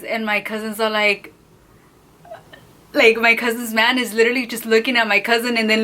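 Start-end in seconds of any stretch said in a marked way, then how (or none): none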